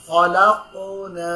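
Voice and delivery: "খলাকনা" drawn out very slowly, recited; slow recitation. Shown as a demonstration. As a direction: recite it slowly for them to repeat. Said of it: "আমি একবার পড়বো".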